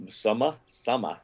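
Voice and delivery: Boston vowel, r dropped: summah; Boston accent. Retold or imitated. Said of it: "That was your Boston accent".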